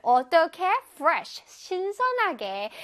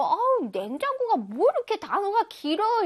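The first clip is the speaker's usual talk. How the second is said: put-on higher, whiny kid complaining about a long word; childish complaint; 6 semitones higher than usual. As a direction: act your younger self, put out. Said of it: "어렸을 때 이 단어를 보고".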